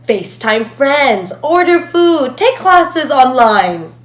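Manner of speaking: loud list climbing in pitch to a near shout; mounting, exasperated cry; building outburst. Acted. Mounting list of online conveniences.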